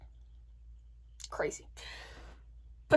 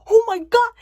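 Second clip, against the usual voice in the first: high, loud panicked exclamation; acted-out shriek of alarm; alarmed cry; 9 semitones higher, 12 dB louder than usual. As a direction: exclaim in horror as a crash comes. Retold moment of imminent crash.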